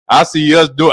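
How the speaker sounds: ordinary talk, nothing marked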